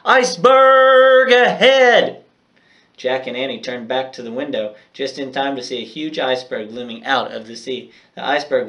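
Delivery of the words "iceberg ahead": much higher, much louder and slow alarm cry; distant lookout shout. Acted. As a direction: yell the warning from the lookout's nest. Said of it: "a shout came from the lookout's nest".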